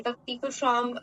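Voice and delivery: ordinary talk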